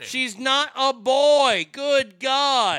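loud, slow exasperated protest; exasperated shout. Real, not acted. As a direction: yell it out in disbelief. Host exasperated by clip insults.

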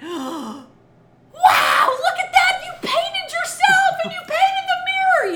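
high, loud, laughing wonder; mother laughing at the lipstick mess; delighted mom. Retold or imitated. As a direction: gush with amazed delight. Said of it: "took a deep breath and went".

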